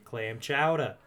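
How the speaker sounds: mock Boston accent. Shown as a demonstration. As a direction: drop the r like a Bostonian. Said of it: "That was a terrible accent".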